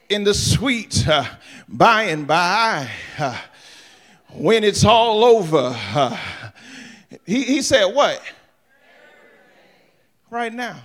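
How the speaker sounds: slow climactic preaching. Acted. Pastor builds the promise to a slow, loud climax. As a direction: stretch each phrase and let it ring.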